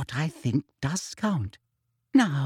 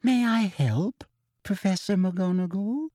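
poisonously sweet; 6 semitones higher than usual. A narrator's reading. Colleague in the doorway offering unwanted help, poisonously sweet. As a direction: coo it up to a questioning rise. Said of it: "in her most poisonously sweet voice".

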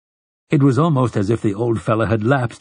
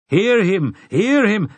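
high-pitched cries of support; crying out; 11 semitones higher, 3 dB louder, slower than usual. A narrator's reading. Three townsmen backing the objector in unison, crying out. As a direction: cry out like hecklers backing a speaker. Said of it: "cried in unison".

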